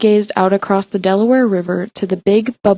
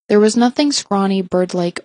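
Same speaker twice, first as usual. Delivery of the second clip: ordinary delivery, nothing marked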